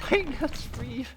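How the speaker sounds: high squeak through laughter; a giggly, lightly voiced peanut lady; retelling the peanut lady while laughing; flight attendant call. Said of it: "she started going".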